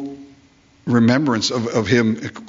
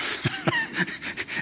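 a short airy laugh at his own joke; breathy laugh; 11 dB quieter than usual. Real, not acted. Preacher laughing at his pressure cooker image, unvoiced.

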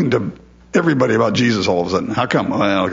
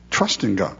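normal talking voice

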